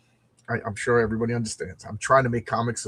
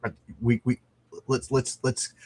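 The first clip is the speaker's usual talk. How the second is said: ordinary talk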